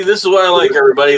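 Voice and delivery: ordinary talk, nothing marked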